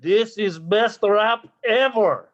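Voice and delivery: thick mock Russian accent; hammy Russian rapper. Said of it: "my mother Russia".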